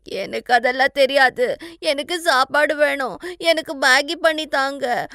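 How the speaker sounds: loud, high whiny demand breaking into sobs; wailing tantrum. Acted. Bride demands Maggi when all food is gone, wailing. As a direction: bawl for food like a spoilt child.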